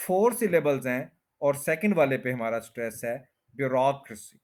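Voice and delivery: ordinary talk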